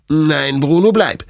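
loud, high-pitched firm refusal; strict owner's command; stern. A narrator's reading. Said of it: "sagt Detlef streng".

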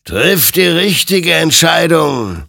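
loud, high, drawn-out warning; ringing spectral exhortation; stern admonition. Acted. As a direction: admonish forcefully, stretching the words. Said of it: "ermahnte ihn sein Gegenüber".

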